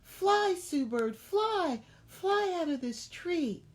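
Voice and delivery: very high, loud urgent cry; frantic mama bird squawking. Acted. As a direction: push her to fly now. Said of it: "squawking ... flapping her wings rapidly, saying".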